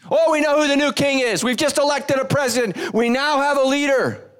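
high, eager proclamation of news; excited announcement. Acted. The crowd announces its newly chosen king, excited.

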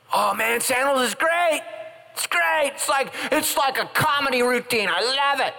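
very high, slow, gushing fan voice; gushing churchgoer. Retold or imitated. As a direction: lay on breathless excitement in a squeaky voice. Churchgoer quoted gushing about church.

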